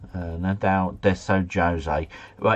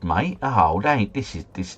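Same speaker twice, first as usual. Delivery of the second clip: normal talking voice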